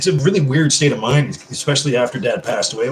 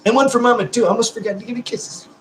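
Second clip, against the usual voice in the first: lightly high, sing-song kiss talk; cutesy voice; 11 semitones higher than usual. Acted. Lightly cooing kisses to Mama on stream. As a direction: coo it like blowing kisses.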